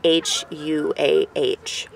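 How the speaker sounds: very slow, letter by letter; slow, evenly spaced letters; spelling out. Shown as a demonstration. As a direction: spell it out one letter at a time. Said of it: "it's spelled Yahuwah".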